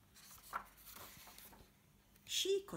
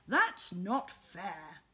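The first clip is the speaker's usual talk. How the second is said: slow, louder, lower protest; slow, firm complaint; indignant Bear; 4 semitones lower, 8 dB louder, much slower than usual. Acted. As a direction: lean on every word, hurt.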